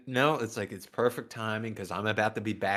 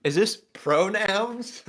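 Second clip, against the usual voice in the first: incredulous, high drawn-out question; high, slow, exaggerated disbelief; Jeff Dye impression; 10 semitones higher, 4 dB louder, slower than usual. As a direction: stretch it out slowly, pitched way up. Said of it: "That's my best Jeff Dye impression".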